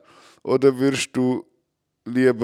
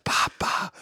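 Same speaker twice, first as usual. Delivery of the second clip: put-on hushed, voiceless child's call; whispering; 7 dB quieter than usual. Child wakes sleeping dad at night, whispered. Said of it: "sie flüstern dir".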